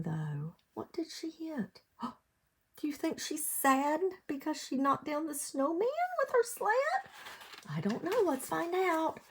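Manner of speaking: ordinary delivery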